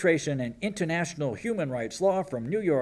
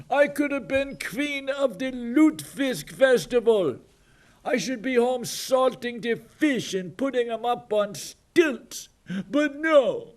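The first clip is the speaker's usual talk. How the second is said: much higher, whiny mocking girl's voice; hammed-up high-pitched pout; mock Swedish girl, pouting; 9 semitones higher, 4 dB louder than usual. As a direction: play the spoiled fishing-village girl.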